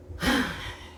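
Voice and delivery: long breathy exhale; heavy sigh. Real, not acted. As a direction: let out a big sigh. Pauses before reassuring the listener.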